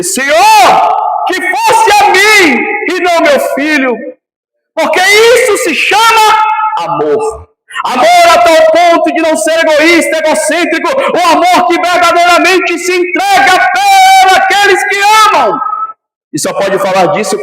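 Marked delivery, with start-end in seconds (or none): 0.0-4.0 loud, drawn-out plea to the Lord, stretching "eu"; father's plea. Retold or imitated. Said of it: "poderia dizer". 4.7-15.6 high, loud, slow preaching climbing to shouts; fiery preaching. Acted. Preaching climax.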